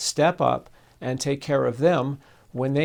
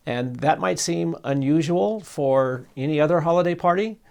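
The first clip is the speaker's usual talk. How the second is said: normal talking voice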